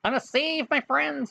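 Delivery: high, nerdy heroic cry; a hint of a nerdy dog hero; nerd voice. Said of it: "I do it in the nerd voice as a dog".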